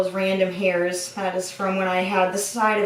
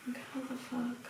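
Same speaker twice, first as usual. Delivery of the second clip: muttered under breath; 3 semitones higher, 18 dB quieter than usual. Real, not acted. Frustrated aside while doing ponytail.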